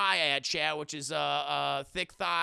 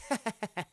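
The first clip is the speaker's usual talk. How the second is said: imitated private snicker; chuckling to himself; 3 dB quieter than usual. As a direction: mimic someone laughing to himself. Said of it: "he's laughing to himself".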